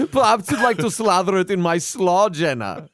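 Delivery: teasing a co-host with a cheesy come-on; mock seductive voice. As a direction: lay the innuendo on thick and silly. Hammy seductive German food offer.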